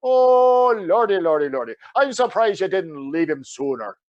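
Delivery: hammed-up Irish fretting; Irish accent. Counselor reads the caller's case, Irish accent. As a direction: tut over it in a broad Irish lilt. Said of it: "sage Irish advice".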